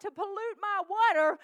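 ordinary talk

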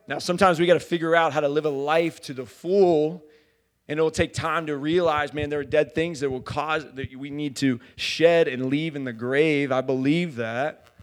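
loud, emphatic preaching. Real, not acted. Preacher declaring a piece of God in every corner, loud preaching. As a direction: drive it home to the congregation.

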